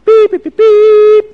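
high, loud mock trumpet blasts; playful trumpet fanfare sound; trumpet imitation. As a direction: toot it like a herald's trumpet. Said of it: "the trumpeters blow the trumpets".

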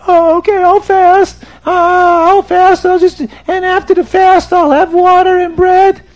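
high, loud mock wail; crying penitent. Sinner pleads with the rabbi, hammed-up crying. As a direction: wail like a desperate, guilt-ridden man. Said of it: "the man is crying and screaming".

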